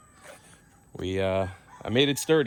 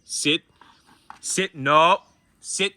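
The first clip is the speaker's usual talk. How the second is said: raised, higher command voice, repeated; stern repeated sit commands; firm dog command; 6 semitones higher, 6 dB louder, much slower than usual. Real, not acted. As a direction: make the dog obey.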